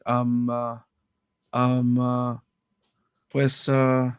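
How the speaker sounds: drawn-out, level ums with long gaps, mock lost for words; mimicking awkward stalling; uncomfortable hesitation. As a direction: imitate a stumped interviewee stalling on monotone filler sounds. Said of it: "uncomfortable to the point where".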